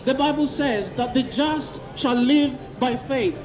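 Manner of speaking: normal talking voice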